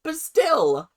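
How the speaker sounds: mock scolding. Real, not acted. Scolding the show.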